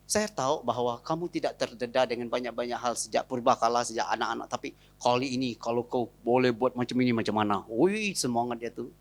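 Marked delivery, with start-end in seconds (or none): 0.0-7.6 soft wife persuading. Retold or imitated. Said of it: "Beritahu dia".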